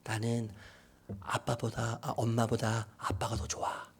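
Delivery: low, soft confession; hushed son confiding; child confiding. Retold or imitated. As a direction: share a secret softly with your father. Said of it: "하루는 그래요".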